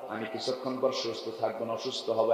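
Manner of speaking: ordinary talk, nothing marked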